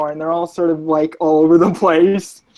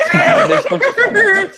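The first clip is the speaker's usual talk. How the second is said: laughing; 4 dB louder, slower than usual. Real, not acted. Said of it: "that's harder for me to do".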